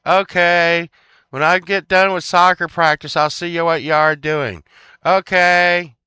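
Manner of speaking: put-on high, loud childish promise; mock kid reply. Friend promising to return after practice, mock kid reply. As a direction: call back like a kid heading out.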